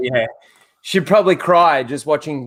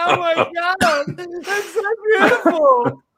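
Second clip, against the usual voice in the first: higher, slow fake-tearful gush; mock sobbing; 12 semitones higher, slower than usual. Mocking her crying over a kiss, much higher and slow. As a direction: ham up a weepy woman.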